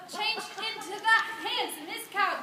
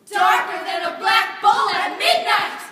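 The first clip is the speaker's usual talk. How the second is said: booming, louder and higher; dramatic build; 4 semitones higher, 15 dB louder than usual. A narrator's reading. Narrator building up the coming thunderstorm, booming loud.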